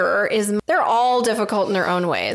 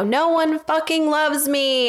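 retold yell; 6 semitones higher, slower than usual. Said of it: "He yelled".

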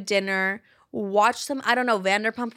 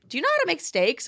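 mock questioning Brooke; 7 semitones higher, faster than usual. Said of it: "Brooke this morning was like".

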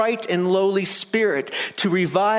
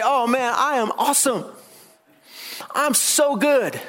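mock self-praise; 7 semitones higher than usual. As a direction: ham up a self-satisfied man. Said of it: "He didn't say".

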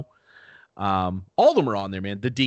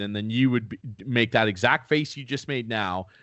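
ordinary talk, nothing marked

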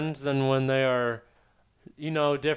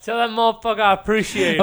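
louder, relishing the punchline; echoing the quote; 6 semitones higher, 8 dB louder than usual. Retold or imitated. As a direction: repeat it like a favourite catchphrase. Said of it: "Imagine you're fucking here".

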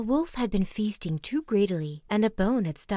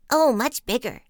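put-on high, loud young frogs exclaiming; loud, raised storybook exclamation; crying out; 5 semitones higher, 8 dB louder than usual. A narrator's reading. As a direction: cry out excitedly as little frogs. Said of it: "they cried".

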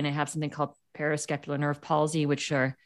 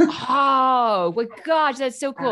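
loud, high gush of admiration; amazed; 8 semitones higher, 6 dB louder, much slower than usual. Retold or imitated. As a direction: re-enact being thrilled, pitched up and loud. Acting out her amazed reaction.

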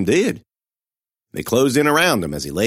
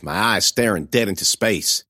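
ordinary delivery

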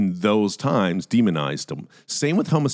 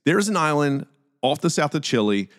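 usual speaking voice

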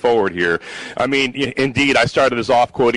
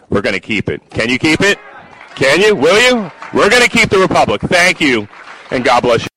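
loud, rousing stump speech; rally the crowd, louder to the end; roaring rallying speech; 3 dB louder than usual. Acted. Builds to rally close.

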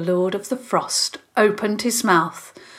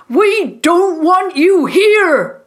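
high, loud, drawn-out shout; loud, indignant yell; 10 semitones higher, 7 dB louder, slower than usual. A narrator's reading. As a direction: shout them away. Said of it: "Mister Brinton shouted".

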